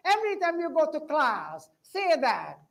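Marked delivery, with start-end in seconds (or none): none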